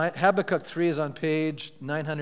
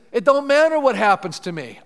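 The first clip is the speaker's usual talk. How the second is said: louder, higher declaration; emphatic proclamation; 6 semitones higher, 9 dB louder than usual. Acted. Pastor voicing Habakkuk's defiant faith, loud and proclamatory. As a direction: declare it with fire. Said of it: "He says".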